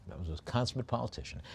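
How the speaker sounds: ordinary talk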